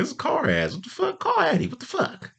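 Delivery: very high-pitched comic freak-out; exasperated alarm. Retold or imitated. Friends realizing Addie has gone missing, high-pitched exasperation. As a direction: squeal it in mock disbelief.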